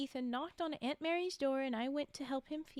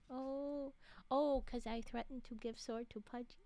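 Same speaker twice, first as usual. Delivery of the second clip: ordinary delivery, nothing marked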